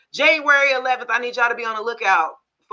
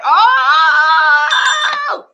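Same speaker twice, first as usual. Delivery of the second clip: high, loud cry of disbelief; shocked disbelief; 11 dB louder, much slower than usual. Real, not acted. Storyteller gasping at the punchline, loud shocked squeal. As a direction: squeal it like you can't believe it.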